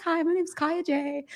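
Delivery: light high imitation of a young girl's hello; imitating a sweet greeting. Host imitating the singer's first video, a touch high and sweet. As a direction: chirp the greeting like a bright-eyed kid. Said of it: "She's like".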